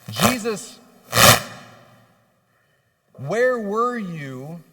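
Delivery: loud, slow, grief-stricken cry of where were you; anguished cry. Retold or imitated. Sister crying out to Jesus, loud slow anguish. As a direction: wail where were you like a broken heart. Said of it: "is it something like".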